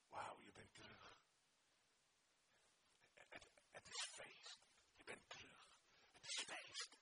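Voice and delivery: tender whisper in the ear; God whispering. Acted. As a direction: whisper it close like a hug. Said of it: "En laat hij fluisteren". (fluisterend als een vader in je oor)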